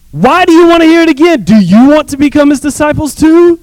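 exasperated sarcasm; high sarcastic retort. Acted. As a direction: needle them sarcastically. Healed man retorts.

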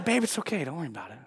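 calm someone down under your breath; soothing. Retold or imitated. Wife soothing her heated husband.

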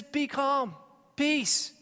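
high, loud call for peace; commanding calm. Acted. Jesus calming the sea, loud and commanding. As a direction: speak peace over the waves. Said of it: "He says".

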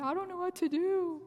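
high-pitched mock crying plea; retold tearful helplessness. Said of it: "hugging her and crying".

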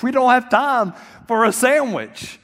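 high, loud exasperated protest; exasperated shout. Retold or imitated. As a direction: plead in panic against the delay. Husband panicked about sandwich.

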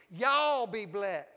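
loud, slow Southern blessing; hearty Southern call with a long Y'ALL. Shown as a demonstration. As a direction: stretch it out big in a Southern accent. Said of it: "bring a little Southern stuff to you".

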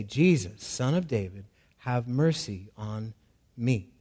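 low, soft, subdued reading of the plea; quiet reading of the cry. Shown as a demonstration. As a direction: read the cry softly, almost to himself. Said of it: "he began to cry out".

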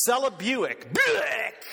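loud comic retch on "Buick"; play-acted barf noise; mock retching. Shown as a demonstration. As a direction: heave the word out like throwing up, pitch shooting up. Imitating vomiting, mock retch.

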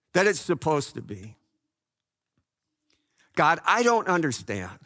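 lightly voiced prayer to God, pleading; a touch of heartfelt pleading; a hint of earnest prayer. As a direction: pray honestly, asking for help. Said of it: "I can go to God and just say".

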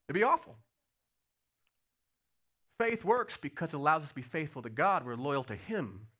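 usual speaking voice

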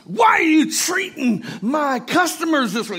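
high, loud scolding; angry manager. Acted. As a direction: shout a furious telling-off. Manager confronts bird while plucking it.

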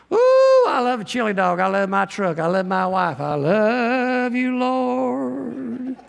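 high squealing "Ooh", then slow sappy "I love you, Lord"; gushing everyman. Retold or imitated. Preacher mocking how people say love for everything, gushy and high. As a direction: ham up a shallow gushy lover. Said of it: "We use it for everything".

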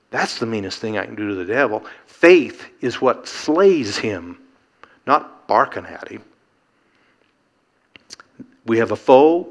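ordinary talk

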